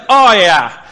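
loud, cocky "oh yeah"; brash disciples. Retold or imitated. Pastor voicing the brash disciples, cocky and loud. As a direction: toss it off like an overconfident dude. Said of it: "They're like".

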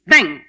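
loud "Bang!" sound effect; mock punch sound. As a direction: bark out the punch noise. Acting out the punch.

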